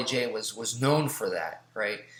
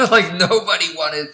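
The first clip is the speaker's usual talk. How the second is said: laughing; 6 semitones higher, 9 dB louder than usual. Real, not acted. Recalling that students dreaded being next, real laughing. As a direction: burst of laughter into higher, louder words.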